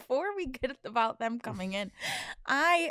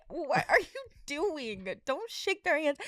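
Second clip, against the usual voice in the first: slow, higher-pitched mock scolding; lightly acted appalled protest; mortified disbelief; 4 semitones higher, 3 dB quieter than usual. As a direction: draw it out, aghast at a partner. Mortified partner watching the handshake.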